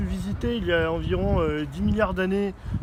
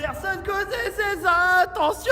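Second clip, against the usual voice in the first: high, loud, slow wailing cry; dramatic cry; 12 semitones higher, 5 dB louder than usual. Acted. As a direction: cry it out in anguish. Dramatic shouted line.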